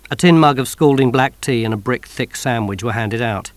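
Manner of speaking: ordinary delivery, nothing marked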